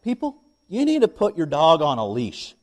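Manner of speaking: retold stern reprimand; a little stern. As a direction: scold them firmly, voice lifted. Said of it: "I said it a little sternly".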